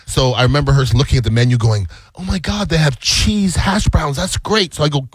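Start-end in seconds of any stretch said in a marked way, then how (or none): 2.1-4.7 mock delight, pitched up, drawn out; retold excitement, higher voice; imitating excited daughter. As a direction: gush like a thrilled kid reading a menu. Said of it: "she's really excited about her cheese hash browns".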